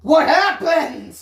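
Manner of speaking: high, loud, slow demand; alarmed question. A narrator's reading. Daniel, shaken, demands to know what happened. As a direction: ask it shaken and urgent.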